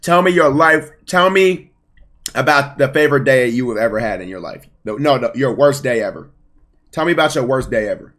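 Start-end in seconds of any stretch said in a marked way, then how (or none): none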